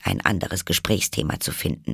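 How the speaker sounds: usual speaking voice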